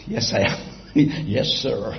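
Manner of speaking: low, sheepish admission with a chuckle. Retold or imitated. Billy Graham answering the policeman who stopped him, sheepish. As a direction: own up meekly, voice dropped low. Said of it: "probably a bit sheepishly".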